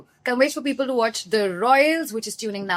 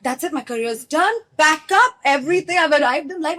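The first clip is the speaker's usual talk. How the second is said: mock triumphant; 7 semitones higher, 5 dB louder than usual. Idol greeted her.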